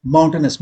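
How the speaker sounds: माउंटेनस said as MOUN-ten-us, no "ee" sound; माउंटेनस, not माउंटेनियस; correct pronunciation. Shown as a demonstration. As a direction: say माउंटेनस with a short plain ending. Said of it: "बोलने पर ही clear हो जाता है".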